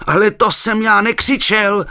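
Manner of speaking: loud high protesting yell; frightened shout. A narrator's reading. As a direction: shout the denial in alarm. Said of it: "zakřičel".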